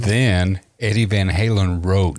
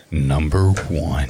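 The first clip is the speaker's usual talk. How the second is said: low, slow dramatic announcement; mock countdown announcer; 6 semitones lower, slower than usual. Host announcing the top spot, mock-dramatic announcer. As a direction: draw it out low like a big reveal.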